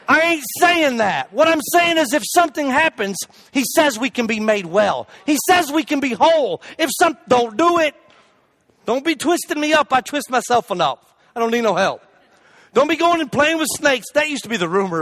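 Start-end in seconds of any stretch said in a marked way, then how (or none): none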